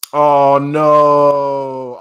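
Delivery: long, drawn-out loud wail; loud dismay. Real, not acted. Reader seeing her sad face, loud dismay.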